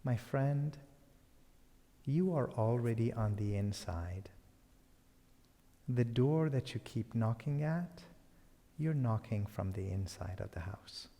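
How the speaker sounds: soft, unhurried answer to a shouter; calm, softened voice of the wise woman; gentle reply. Acted. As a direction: answer the bluster quietly and kindly.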